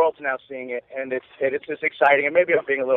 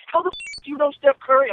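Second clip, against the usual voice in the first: much higher, astonished retold outburst; amazed friends; 11 semitones higher than usual. Friends behind the season ticket holder, stunned disbelief. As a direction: act out friends' jaw-drop disbelief.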